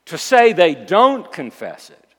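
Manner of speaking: loud, insistent sermon with punched key words; emphatic preacher in full flow; forceful preaching. Acted. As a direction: hammer each point from the pulpit.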